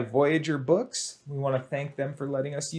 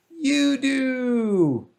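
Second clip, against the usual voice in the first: reveal; 9 semitones higher, 5 dB louder than usual. Acted. Big reveal to kids.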